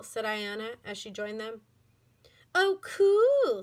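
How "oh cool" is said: high, loud sing-song mocking. A narrator's reading. Girl mocking from behind, loud sing-song. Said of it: "said a sing-song voice".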